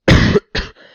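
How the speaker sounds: harsh, real chesty cough; coughing fit. Real, not acted. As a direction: cough hard into the mic. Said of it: "excuse me".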